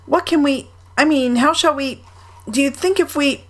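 high, halting, unfinished questions; distressed. A narrator's reading. Pooh wonders how to help Eeyore, distressed. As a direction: fumble for words in high-pitched worry. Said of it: "said Pooh in distress".

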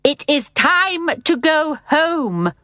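high, very slow, ringing command; stern nanny calling over the din; trumpeting announcement. A narrator's reading. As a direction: draw out each word like a trumpet call. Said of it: "voice sounded above the roars of laughter like a trumpet".

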